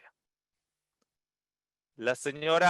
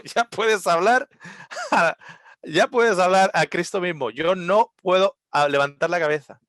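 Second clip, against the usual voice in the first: loud stubborn refusal; 4 semitones higher, 9 dB louder than usual. Retold or imitated. Barman refusing to lift his head, loud and defiant. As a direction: dig in and refuse.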